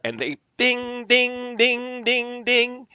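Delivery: bell imitation. Mimicking a bell.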